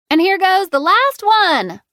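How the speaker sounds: loud, high, drawn-out yell. A narrator's reading. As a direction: call it out to the whole yard. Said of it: "Lily yelled".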